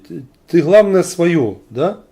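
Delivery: usual speaking voice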